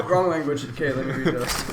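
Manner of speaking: ordinary talk, nothing marked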